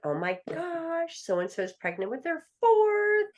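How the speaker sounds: high, slow, gushing excitement; imitating delighted relatives; mock gushing. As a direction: squeal it like the whole room is thrilled. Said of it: "everybody's like".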